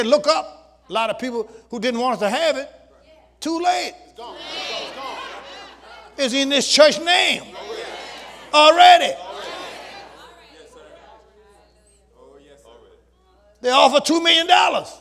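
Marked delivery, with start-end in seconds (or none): 3.4-14.9 loud, high, fervent preaching; rising pulpit fire, louder and higher; preaching at full force. Acted. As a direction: drive it home like a preacher refusing a bribe. Preaching about refusing the bribe.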